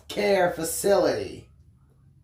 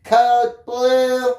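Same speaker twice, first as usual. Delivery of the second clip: flat, louder robotic PA voice; robotic announcement; 4 semitones higher, 5 dB louder than usual. Acted. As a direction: call it out like a machine over a speaker. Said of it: "I heard a robotic voice call out".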